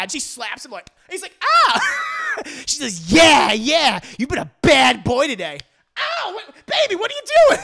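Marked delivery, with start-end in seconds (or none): 1.4-2.0 high, loud "ah!" yelp; mock yelp of pain. Husband slapped by his wife. 3.1-5.6 loud, higher, hammed-up dominatrix; mock domineering shout. Wife roughly dominating startled husband. 6.0-7.6 very high, loud startled husband; mock alarmed protest.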